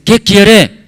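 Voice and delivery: high, loud acted question; direct demand. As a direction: ask outright, playing the president. Acts president asking what's wanted.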